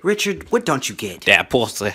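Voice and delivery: normal talking voice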